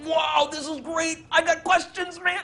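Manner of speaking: excited. Retold or imitated. Dreamer thrilled to meet the dead, high and excited. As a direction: burst out with delight, drawing it out.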